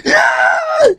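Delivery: very high shrieked ah; mock scream. Imitating Maggie seeing Glenn surrounded, shrieked scream. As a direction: shriek it out in alarm. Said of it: "and Maggie's like".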